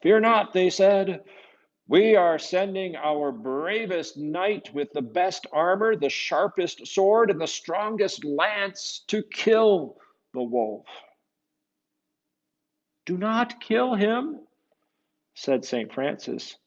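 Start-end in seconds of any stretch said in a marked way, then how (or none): 0.0-0.5 high, loud, proud reassurance; bold town leaders. Acted. Town leaders reassuring the Contessa, loud and proud. 1.9-10.9 higher, loud, slow proud boast; boastful town leaders. Acted. Leaders boasting of their knight, loud and grand. 13.1-14.5 higher, slow, earnest plea; pleading Saint Francis. Acted. Francis pleading with the town, slow and earnest.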